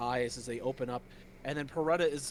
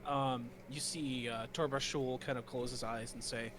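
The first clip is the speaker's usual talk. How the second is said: ordinary talk, nothing marked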